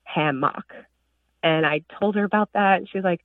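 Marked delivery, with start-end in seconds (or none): 0.0-0.7 hammock with a full MOCK at the end; unfamiliar pronunciation. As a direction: say hammock slowly as HAM-MOCK. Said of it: "never heard anybody say hammock".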